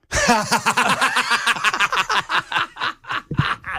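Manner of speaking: burst of laughter. Real, not acted. Host laughs at the office story, big high laugh.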